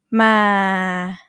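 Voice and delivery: Thai low tone on "Ma", female pitch. Shown as a demonstration. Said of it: "I can do female voice too".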